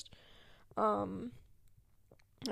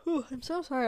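talking through a yawn; words stretched by a real yawn; 2 semitones higher than usual. Real, not acted. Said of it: "I don't know why I'm yawning".

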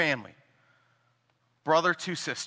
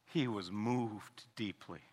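much softer, slow and grave; hushed; 8 semitones lower, 13 dB quieter, slower than usual. Acted. Preacher lingers on Jesus being moved, hushed and slow. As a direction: let it sink in quietly. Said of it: "solemn hushed line".

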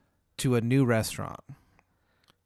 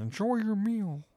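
put-on high, slow Chicago accent farewell; maître d' leaving the table, Chicago accent; 9 semitones higher than usual. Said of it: "Chicago accent".

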